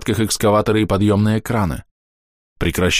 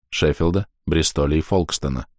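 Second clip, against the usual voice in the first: usual speaking voice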